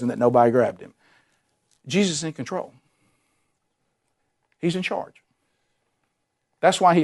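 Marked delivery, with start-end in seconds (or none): 1.9-5.2 soft, weighty declaration; hushed assurance. Acted. Preacher assuring a troubled congregation, softly and firmly. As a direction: let it land quietly. Preacher drops to near hush.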